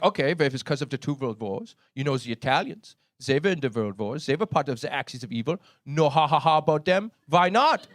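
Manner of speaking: put-on German-accented protest; German accent, building to an indignant question; German friend arguing. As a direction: argue the point in a mock German accent. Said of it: "he gets mad 'cause I make fun of the Germans".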